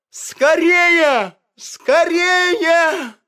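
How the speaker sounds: high, loud despairing cries; panicked wailing. A narrator's reading. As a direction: lament then urge each other on. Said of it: "кричали на улице звери".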